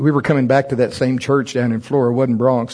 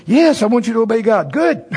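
lady's answer; 9 semitones higher, 3 dB louder than usual. Retold or imitated. Dialogue with the lady.

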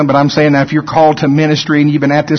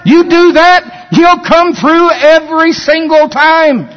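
preaching at full force; 9 semitones higher than usual. Acted. Preacher urging the congregation to trust God, building to a shout. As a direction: climb to a shouted climax, pressing every line.

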